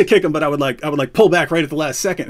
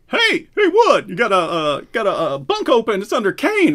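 country guard voice; 5 semitones higher than usual. Retold or imitated. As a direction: mimic the guard offering the bunk. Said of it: "Fix comes up to me and says".

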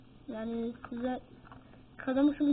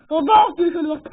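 excited shout; 11 dB louder than usual. Real, not acted. Excited showing viewers.

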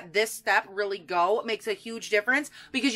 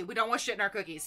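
quiet aside; 4 semitones lower, 7 dB quieter, faster than usual. Acted.

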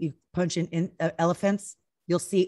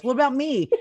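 higher, louder imitation of a left-out husband; mock plaintive protest; 6 semitones higher, 5 dB louder than usual. Husband asks wife about being missed, mock plaintive. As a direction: play up being left out, voice raised.

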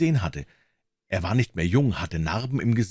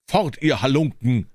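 raised, higher-pitched command; calling out; 5 semitones higher, 5 dB louder than usual. A narrator's reading. Devil orders two grave watchers away, calling out. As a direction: call it out to chase them off. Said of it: "rief er ihnen zu".

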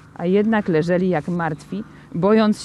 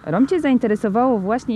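usual speaking voice

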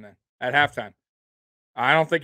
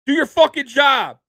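loud high furious yell at linemen; Peyton Manning yelling; 10 semitones higher, 8 dB louder than usual. Retold or imitated. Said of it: "You've seen Peyton Manning yell at offensive linemen".

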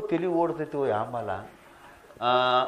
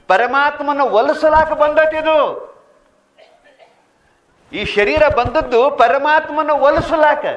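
preaching at full force; 10 semitones higher, 9 dB louder than usual. Acted. Preacher warning the crowd about intoxicants, fervent high loud sermon. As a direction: thunder the scripture at the crowd.

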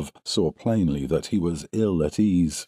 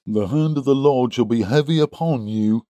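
higher-pitched pious threat; crying out; 6 semitones higher than usual. A narrator's reading. Second suitor threatens the farmer with God's wrath, crying out. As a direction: call down judgement in a raised voice. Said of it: "cried young Drebber".

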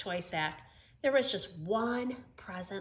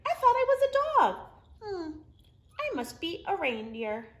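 much higher, louder, fast realization; surprised dog voice; 8 semitones higher, 6 dB louder than usual. Acted. Olive realizes she is a reindeer, high and surprised. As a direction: squeak it out in amazement. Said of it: "said Olive".

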